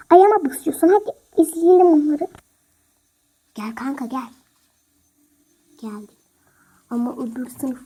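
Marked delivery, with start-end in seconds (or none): 0.1-2.3 loud complaint. Acted. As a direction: yelp that your foot hurts. Foot stepped on, complaining. (ayağına basılmış, acıyla yüksek sesle sızlanma)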